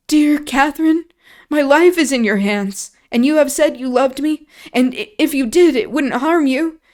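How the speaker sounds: put-on terrified boy begging Catherine not to leave; desperate pleading. A narrator's reading. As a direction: plead for your life through tears. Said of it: "he sobbed".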